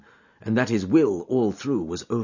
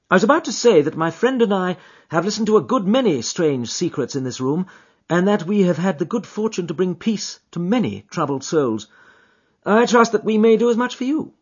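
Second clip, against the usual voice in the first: a lightly amused detective, raised pitch; smiling Holmes; 9 semitones higher, 4 dB louder than usual. Acted. Holmes explaining the hat to the visitor, lightly amused. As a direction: tease him kindly.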